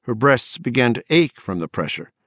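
plain reading voice

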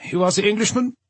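put-on high-pitched German-accented question; German accent, rising sharply at the end; German soldier. German soldier questions captured pilot.

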